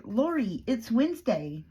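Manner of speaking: exasperated correction. Retold or imitated. Boss telling Lori what day it is, exasperated. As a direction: spell it out for her, mock exasperated.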